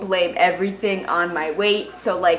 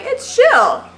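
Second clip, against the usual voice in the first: a sharp high squeak of fake calm; mock nonchalance; 5 dB louder than usual. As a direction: chirp it out to hide the hurt. Pretending she doesn't care.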